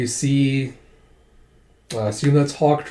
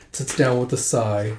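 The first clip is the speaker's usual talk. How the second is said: ordinary talk, nothing marked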